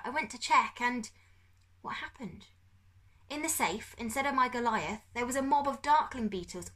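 dazed captive father. A narrator's reading. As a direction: recount it as if still bewildered.

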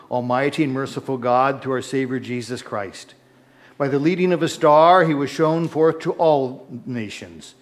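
normal talking voice

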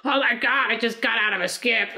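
Loretta voice. Retold or imitated. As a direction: throw it out like a dramatic party girl. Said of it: "doing her tweets of, like".

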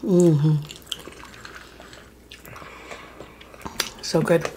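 talking while chewing food; words muffled by food; mouth full. Real, not acted. Seen 1: Face mostly hidden by fork; before and after, cheeks look full and chewing.

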